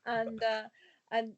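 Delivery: normal talking voice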